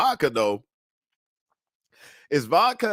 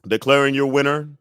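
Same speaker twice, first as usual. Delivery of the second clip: ordinary delivery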